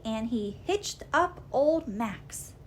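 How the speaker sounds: ordinary delivery, nothing marked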